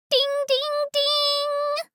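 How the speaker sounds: sung-out "ding, ding, ding" on one high note; imitated chime. Tour guide mimics the station arrival bell, high chanted chime.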